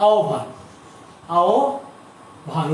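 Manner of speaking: আহ্বান said as "aobhan", drawn out slowly; correct pronunciation. Shown as a demonstration. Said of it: "উচ্চারণটা কিন্তু".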